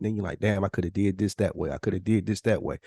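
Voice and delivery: ordinary talk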